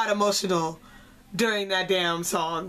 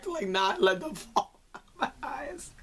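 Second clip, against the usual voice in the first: soft laugh while holding back tears; laughing through tears; 4 semitones higher, 5 dB quieter than usual. Real, not acted. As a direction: giggle as if tearing up.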